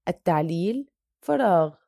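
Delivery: usual speaking voice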